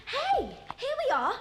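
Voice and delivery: high, excited call-out; excited discovery. Acted. Child finds something in the car, excited discovery. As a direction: call out in triumph at a find.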